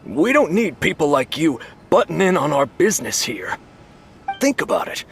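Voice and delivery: high, combative rant at Paimon; defiant rant. Acted. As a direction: push back hard, indignant. Buyer argues with Paimon.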